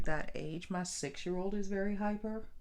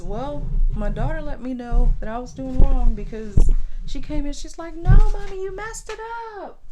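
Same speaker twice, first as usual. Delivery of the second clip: put-on much louder, high child's cry; loud child scolding; 8 semitones higher, 17 dB louder than usual. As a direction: belt out the kid's no mommy. Sister retells daughter's scolding.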